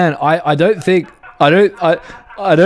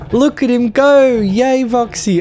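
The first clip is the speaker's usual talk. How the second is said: excited cheering; 11 semitones higher than usual. Real, not acted. Cheering on the truck.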